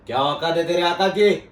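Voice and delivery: loud, high-pitched outburst of contempt; furious shout. Acted. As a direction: roar it as an angry challenge. Acted interrogation, furious challenge. (गुस्से में चिल्लाकर ललकारना)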